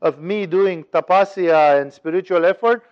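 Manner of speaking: usual speaking voice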